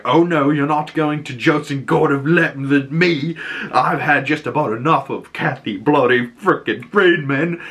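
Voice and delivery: fed-up outburst. Retold or imitated. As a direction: act out an exasperated character about to storm off. Trevor fed up before storming out.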